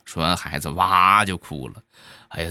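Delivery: usual speaking voice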